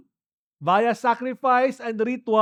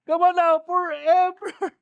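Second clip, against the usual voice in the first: high, excited cry of joy with a laugh; joyful shout; 10 semitones higher, slower than usual. Real, not acted. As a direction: let the joy burst out. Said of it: "sermon climax, laughs". (masayang sigaw na may tawa)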